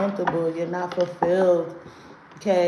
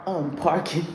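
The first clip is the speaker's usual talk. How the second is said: giggling at own slip; 4 dB quieter than usual. Real, not acted. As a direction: laugh at the mistake.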